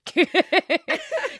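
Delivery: big high burst of laughter; loud laugh. Real, not acted. Laughs at own story.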